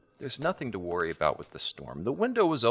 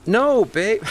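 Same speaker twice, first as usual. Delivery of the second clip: reassuring protest; 12 semitones higher, 10 dB louder than usual. Acted. Quick loud reassurance.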